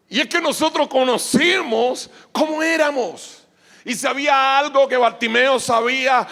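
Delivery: high, loud preaching at full force. Real, not acted. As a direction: hammer each phrase out to the congregation.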